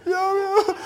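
very high, loud mock wail; hammed-up outraged crying. Bonquisha wailing at the TV result, mock high wail. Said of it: "Bonquisha's over there just mad".

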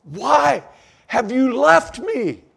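high, loud, pleading cry; anguished psalmist. Acted. Psalmist pleading with God for help, high anguished cry. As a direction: cry out to God like a wounded man. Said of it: "the psalmist is saying".

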